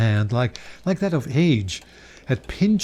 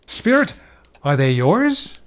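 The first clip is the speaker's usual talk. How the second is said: high, louder startled question; appalled; 3 semitones higher, 4 dB louder than usual. Acted. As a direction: recoil in horror as you ask. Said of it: "Scrooge startled back, appalled".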